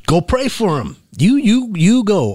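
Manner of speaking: high, insistent retold urging. Man urges his friend to go pray, insistent. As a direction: push him to go, pitch jumping up. Retold back-and-forth, pushing task away.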